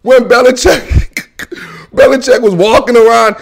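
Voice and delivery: ordinary delivery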